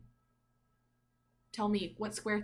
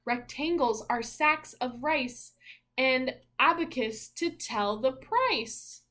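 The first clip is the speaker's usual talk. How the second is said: bright, louder picture-book reading voice; reading aloud; 3 semitones higher, 6 dB louder, slower than usual. Acted. Parent reading a picture book to little Zeke, in a rhythmic storybook voice. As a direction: read each rhyme out like a storybook page.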